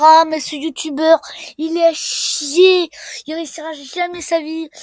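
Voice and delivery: loud, high-pitched imitation of complaining viewers; mocking viewers. Player imitates viewers slamming the youtuber, loud mock complaint. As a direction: mimic the haters, high and loud. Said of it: "Vous êtes en train de vous dire".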